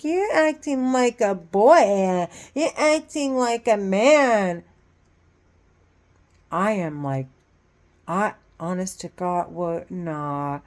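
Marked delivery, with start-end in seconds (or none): 0.0-4.6 mocking taunt. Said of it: "He's like".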